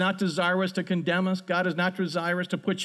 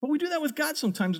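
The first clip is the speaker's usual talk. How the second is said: ordinary delivery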